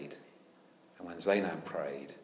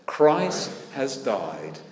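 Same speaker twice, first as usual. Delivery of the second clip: slow, deliberate recital, leaning in; slow congregational response; 6 dB louder, much slower than usual. Shown as a demonstration. Preacher asks the church to repeat, slow and firm. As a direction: say it once more, firmly together. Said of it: "Once more".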